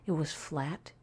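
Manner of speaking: usual speaking voice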